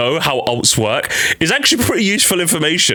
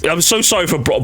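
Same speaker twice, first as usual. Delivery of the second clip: ordinary talk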